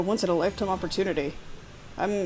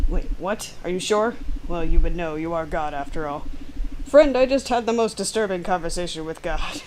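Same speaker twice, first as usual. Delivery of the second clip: ordinary delivery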